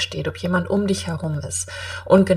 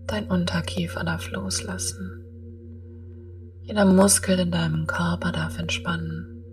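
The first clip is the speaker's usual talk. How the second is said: guided meditation; 9 dB quieter than usual. Acted.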